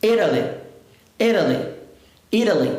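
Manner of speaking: "Italy" stressed on the first syllable, T as a tapped R; flap T. Shown as a demonstration. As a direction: say "Italy" like "írali". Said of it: "vai ter som de R".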